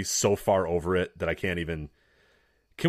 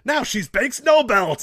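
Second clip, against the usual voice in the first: high, loud, drawn-out mock yell; exasperated parody of ringside screaming; imitated commentator scream; 10 dB louder, slower than usual. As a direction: holler it like an overwrought announcer. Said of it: "Corey Graves screaming".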